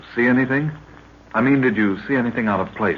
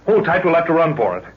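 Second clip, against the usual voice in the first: higher, louder, pressing; urgent; 6 semitones higher, 4 dB louder than usual. Acted. Jack carrying Lorna away from lions, urgent. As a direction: push on in a hurry.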